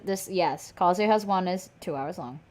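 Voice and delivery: usual speaking voice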